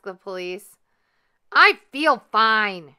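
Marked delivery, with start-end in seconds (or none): 1.5-3.0 very loud, slow shout. A narrator's reading. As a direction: bellow each word slowly. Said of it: "shouted Frizzle".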